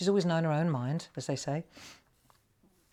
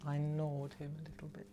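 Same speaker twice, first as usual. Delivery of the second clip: much softer, half to herself; softly repeated quote; 2 semitones lower, 12 dB quieter than usual. Retold or imitated. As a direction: murmur it, still amused. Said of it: "Try not to laugh".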